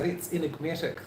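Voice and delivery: soft New Zealand accent, vowels squeezed in that's enigmatic; Kiwi accent. Shown as a demonstration. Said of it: "To practice my Kiwi accent".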